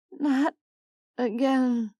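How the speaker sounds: drowsy slurred words. Acted. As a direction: slur it half asleep. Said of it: "she slurred".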